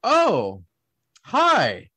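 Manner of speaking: stunned, drawn-out greeting with a gap between words; high, falling swoops, taken aback; shocked greeting. Retold or imitated. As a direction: act out a slow, startled realization. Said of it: "I was kinda shocked".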